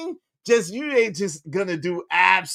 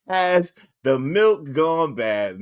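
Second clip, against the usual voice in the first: ordinary talk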